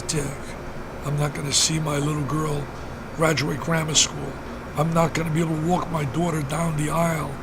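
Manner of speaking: low, subdued imitation of a grieving friend; tearful, retold. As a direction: retell the tearful words softly, without real sobbing. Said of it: "he's crying on the phone".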